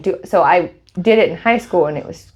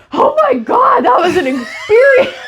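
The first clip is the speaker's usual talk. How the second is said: very high mock-frantic shout; retold frantic exclamation; 4 dB louder than usual. Dreamer to partner after waking, retold frantic exclamation. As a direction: cry it out high, half laughing. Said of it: "I'm like".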